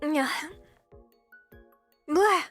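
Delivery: usual speaking voice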